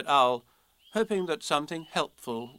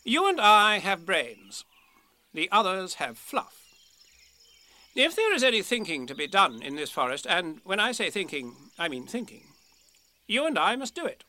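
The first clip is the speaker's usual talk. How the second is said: higher-pitched, emphatic Rabbit; raised, insistent storybook animal voice; self-important Rabbit, brisk-minded and bossy; 4 semitones higher than usual. Acted. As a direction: lay down the law as Rabbit.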